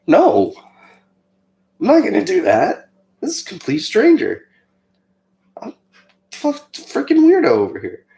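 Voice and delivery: high, squeaky mock protest; whiny refusal. Refusing God's prompt to comfort a stranger, high whiny protest. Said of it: "I was like".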